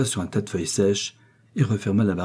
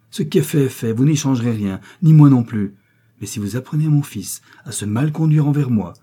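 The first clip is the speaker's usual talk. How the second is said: higher, louder emphatic retort; firm indignation; 5 semitones higher, 6 dB louder than usual. A narrator's reading. Mother warning Victoire about her son, firm and heated. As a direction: put the rival in her place forcefully.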